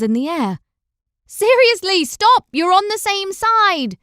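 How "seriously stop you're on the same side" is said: high, loud frantic yell; yelling while running. A narrator's reading. Una yelling at two knights while running toward them. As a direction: shout over the clash of swords. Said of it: "she yelled as she ran".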